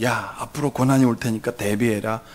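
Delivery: low, matter-of-fact heads-up; offhand warning. Acted. God supposedly warning believers of trouble, offhand. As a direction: warn casually in a low voice. What God does not say.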